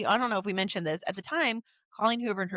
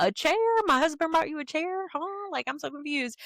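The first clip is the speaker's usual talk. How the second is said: high, puzzled questioning; playfully baffled; confused author; 7 semitones higher than usual. Retold or imitated. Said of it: "she's just like".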